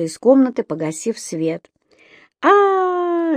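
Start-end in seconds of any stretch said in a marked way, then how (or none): 2.4-3.4 high, drawn-out "aaah" cry; acted frightened wail, pitched way up; desperate child's wail. A narrator's reading. Little Еля wailing from bed after lights out, desperate wail. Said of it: "раздался отчаянный Елен вопль".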